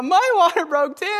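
high, mock whiny joke voice; joking whine. Husband joking to the midwife, high mock whine. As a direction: whine it like you're the one in labor. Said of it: "I was like".

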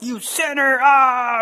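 hammed-up wrathful accusation ending in a roar; mock condemnation. Mock God condemning a stumbling believer, wrathful. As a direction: pretend to thunder at a sinner.

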